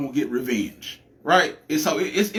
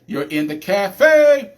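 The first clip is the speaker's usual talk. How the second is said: announcer tagline; 5 semitones higher, 8 dB louder, slower than usual. Acted. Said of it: "J Talk Podcast".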